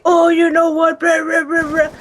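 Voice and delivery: imitating gamers trash talking in the lobby; mocking lobby troll. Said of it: "every time you get in the lobby".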